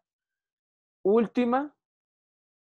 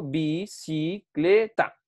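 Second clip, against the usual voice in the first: "bicicleta" slowly split into bi, ci, cle, ta; syllable by syllable; much slower than usual. Shown as a demonstration. Teacher recaps the divided word, syllable by syllable. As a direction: spell out "bicicleta" in slow separate syllables. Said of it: "Entonces ya tengo".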